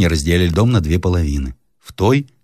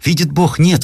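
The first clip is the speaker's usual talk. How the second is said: frightened cry; 11 semitones higher, 3 dB louder than usual. Acted. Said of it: "вскричала старуха".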